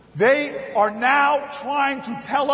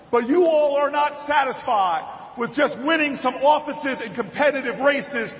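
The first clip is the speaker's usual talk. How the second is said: normal talking voice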